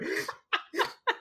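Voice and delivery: breathy, unvoiced high giggle; wheezing high laugh; airy high laugh. Real, not acted. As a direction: snicker at your own joke. Cracking up after an improvised line.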